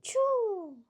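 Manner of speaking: high falling choo; sneeze release. Acted. Gerald finally sneezes, high and falling. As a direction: let the sneeze drop out.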